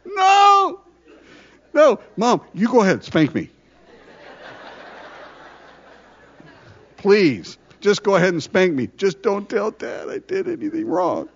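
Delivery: high-pitched, hammed-up begging child; pleading kid. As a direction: shout a mock-panicked no, then plead high. Child pleading with mom, acted.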